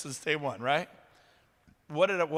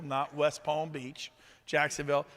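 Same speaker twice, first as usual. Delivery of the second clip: normal talking voice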